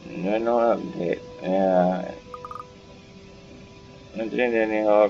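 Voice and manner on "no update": normal talking voice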